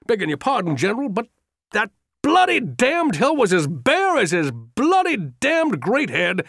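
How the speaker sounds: high, urgent, indignant officer's voice; a breathless old soldier venting his fury; agitated old general. Acted. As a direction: pour it out, outraged and pleading. Trimble's agitated outburst to Lee.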